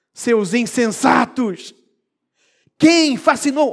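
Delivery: muito mais alto e agudo, indignado; repreensão forte. Shown as a demonstration. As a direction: solta como um grito de indignação. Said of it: "Será que é esse o tom?". (Seus insensatos! gritado com indignação)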